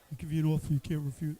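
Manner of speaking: a quick hint of the Godfather making his offer; Godfather impression. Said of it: "The Godfather".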